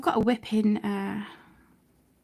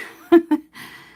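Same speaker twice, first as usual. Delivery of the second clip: loud laugh; 7 semitones higher, 14 dB louder than usual. Real, not acted. Laughing at the awkward delivery.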